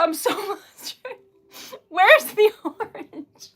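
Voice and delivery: high, giggly and slow; laughing through the nerves; flustered laughing. Real, not acted.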